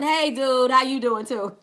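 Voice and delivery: normal talking voice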